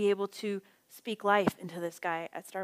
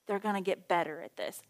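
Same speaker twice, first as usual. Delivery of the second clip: usual speaking voice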